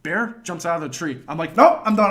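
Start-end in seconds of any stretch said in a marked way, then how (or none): 1.6-2.1 loud, high panicked refusal; scared mock yell. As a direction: yell it and bail out. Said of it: "I'm, like, screaming".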